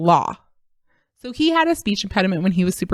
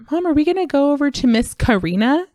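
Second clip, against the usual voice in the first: put-on higher child's question to mom; pitched-up mimicry of a kid asking; imitating young son; 7 semitones higher than usual. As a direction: ask it like a little boy. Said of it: "if he says Miss Karina".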